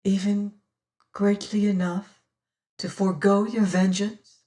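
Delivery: usual speaking voice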